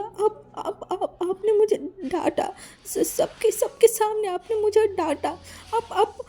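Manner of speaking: put-on high, tearful, childish complaint; sobbing high and whiny about being scolded in front of everyone; drunken whining cry. A narrator's reading. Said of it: "रोने वाला शक्ल बना लिया".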